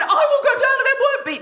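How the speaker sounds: high, loud, laughing defiance; defiant retort. Retold or imitated. Wife insists to husband she'll check the pub, high and loud. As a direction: throw it back at him.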